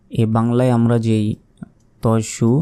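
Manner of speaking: ordinary talk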